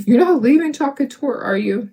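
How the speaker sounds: high, quick mock-panicked question; imitated freak-out. Customers asking whether she is leaving, imitated freak-out. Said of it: "I had people freaking out".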